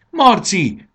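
loud, high cry of the name; shouted call. A narrator's reading. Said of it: "kiáltotta Fanny". (magas, hangos kiáltás)

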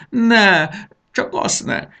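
high loud wail fading to a breathy plea. Acted. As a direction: wail in mock horror. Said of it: "jajveszékelt Samu".

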